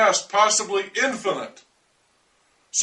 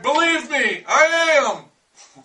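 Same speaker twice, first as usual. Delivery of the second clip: higher, louder shout back, defiant; yelling back; 6 semitones higher, 3 dB louder, much slower than usual. Retold or imitated. Yelling back at the man who called him crazy, loud and high. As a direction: fire it right back at him. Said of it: "And I yelled back".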